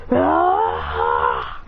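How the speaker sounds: long, rough, breath-heavy "ah"; fighter's battle cry. Acted. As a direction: exhale a drawn-out attack roar. Acted fighter raising fire tornado.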